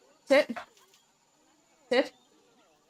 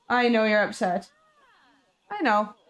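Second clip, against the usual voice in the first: ordinary talk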